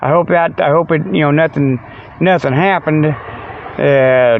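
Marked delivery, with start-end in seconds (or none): none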